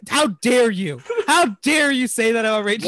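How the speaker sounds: mock outrage. Mock outrage defending actress.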